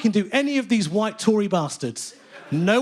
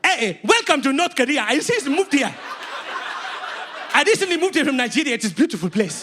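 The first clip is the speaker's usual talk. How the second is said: big, higher-pitched caricature Nigerian accent; hearty welcome in a put-on Nigerian accent; 5 semitones higher than usual. Shown as a demonstration. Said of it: "Name a country and I'll do the accent".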